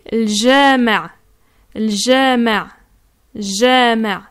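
jama' with a drawn-out A, slow; long A in jama'. Shown as a demonstration. Teacher showing jama' for mosque. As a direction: stretch the A in jama'. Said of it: "un A ... de manière longue".

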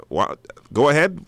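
ordinary talk

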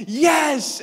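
loud, high cheer; excited yes. Retold or imitated. Dad answering daughter, loud happy shout. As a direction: shout it with glee. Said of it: "And I'm like".

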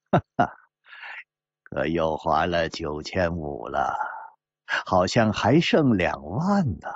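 short laugh, then a low amused remark. Acted. Moustached gambler counting his rival's remaining money, amused. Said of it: "小胡子忽然笑了".

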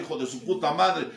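usual speaking voice

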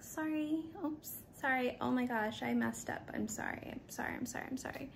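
high sorry shrinking to a whisper; mock apologizing fading away. Chronic apologizer saying sorry, fading to a whisper.

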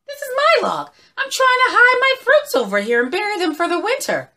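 very high, loud, chattering squirrel; high-pitched fussy little critter; indignant squirrel. Acted. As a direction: scold the intruder in a squeaky squirrel voice. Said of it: "And Mister Squirrel said".

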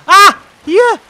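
high, loud excited outcry; excited shout. Real, not acted. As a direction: shout out the discovery. Finds death spot.

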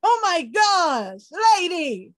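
high, loud amazed outburst stretched slow; loud wide-eyed amazement, drawn out; excited disbelief. Retold or imitated. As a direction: gasp it out like a thrilled stranger hearing news. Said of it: "They're so excited".

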